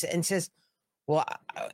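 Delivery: ordinary talk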